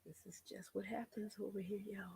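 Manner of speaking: hushed whisper to viewers. Real, not acted. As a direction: whisper it under your breath. Whispered aside.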